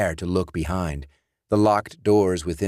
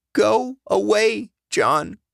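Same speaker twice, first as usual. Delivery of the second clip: much higher, pleading woman's voice; frightened Alice; 4 dB louder, slower than usual. Acted. Alice begging dead John to go away, high and afraid. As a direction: beg him to leave.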